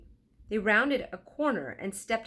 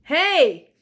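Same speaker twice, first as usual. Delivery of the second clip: loud, high angry shout; 9 semitones higher, 14 dB louder than usual. Acted. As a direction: yell to stop them. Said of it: "shouted the bird seller".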